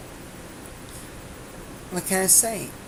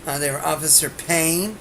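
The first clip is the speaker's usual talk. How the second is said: ordinary delivery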